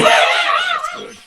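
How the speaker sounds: very high, loud squeal while laughing; squeaky laughing voice. Pretend. Laughing repeat of quote.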